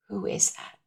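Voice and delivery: whispered, stunned; shocked whisper. Real, not acted. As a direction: breathe it out in disbelief. Shock at mirror.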